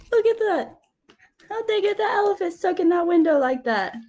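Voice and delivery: ordinary delivery, nothing marked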